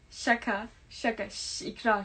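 ordinary delivery

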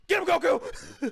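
high, drawn-out battle call; slow, high attack command; rallying cry. Real, not acted. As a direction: call out to send a fighter in. Calling on game character to attack.

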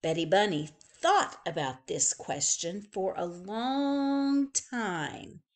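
ordinary delivery

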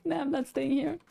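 mock refusal. Quoting a scared player's teammate who stayed, mock refusal.

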